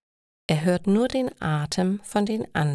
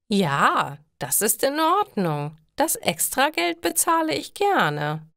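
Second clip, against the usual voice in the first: pleased; 7 semitones higher than usual. A narrator's reading. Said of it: "Frau Weber wirkt erfreut".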